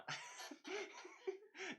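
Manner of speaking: sudden high giggle; real amused laugh; high burst of laughter. Real, not acted. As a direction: laugh it off at the joke. Laughs at Amy's teasing.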